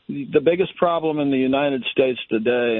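ordinary talk

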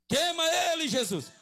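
high, full-force cry to Jesus; shouted prayer command. Real, not acted. Preacher praying over a possessed woman, shouting. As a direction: shout it out to burn the spirit away.

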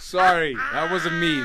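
high laughing apology. Real, not acted. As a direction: laugh through the sorry. Laughing denial.

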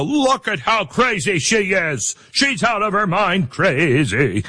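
high, exaggerated jeering; mocking ridicule. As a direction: make fun of her like a scandalized older critic. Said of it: "would make fun of her and go".

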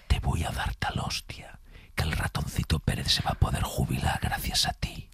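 menacing whisper; threatening ASMR whisper. Shown as a demonstration. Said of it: "Ahora la susurrada".

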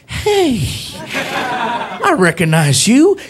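slow, swooping high recognition; imitated delighted cop. Officer recognizing comedian at car window, slow delighted squeal. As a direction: draw it out, rising to a high squeal. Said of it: "He's like".

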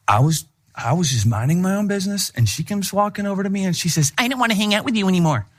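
a light put-on voice of a complaining daughter; mimicking daughter. Daughter complaining to dad about a schoolmate, lightly voiced. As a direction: retell the story as a mildly wronged kid. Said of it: "And she said".

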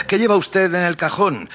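high, urgent call from behind; eager, high-pitched cry on the run; shouted question. A narrator's reading. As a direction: shout it after him, voice way up. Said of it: "le grité corriendo tras él".

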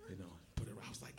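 ordinary delivery

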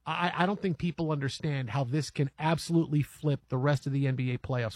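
ordinary delivery

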